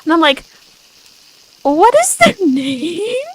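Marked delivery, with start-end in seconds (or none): 1.6-3.2 high, slow, giggling question; laughing disbelief. Retold or imitated. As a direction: ask through a laugh, stunned. Retelling own reaction to fans.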